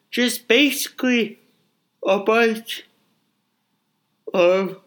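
yawning mid-sentence. Real, not acted. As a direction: talk through a yawn. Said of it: "I gotta stop yawning".

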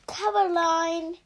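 loud call across the house; shouting. Acted. Mother shouting from the kitchen, loud call. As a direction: yell her name from the kitchen. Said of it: "Miss Smith was shouting at him".

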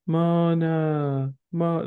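put-on "Mona", high, said like a little toddler calling out.

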